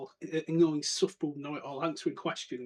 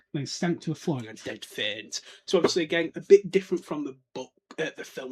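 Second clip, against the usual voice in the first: normal talking voice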